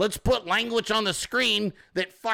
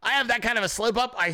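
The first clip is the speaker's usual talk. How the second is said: ordinary talk, nothing marked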